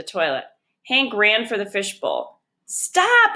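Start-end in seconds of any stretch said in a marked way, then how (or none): 2.7-3.3 much higher, louder cry; sharp mock yell; yelled stop. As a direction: shout to halt him. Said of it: "yelled Janie".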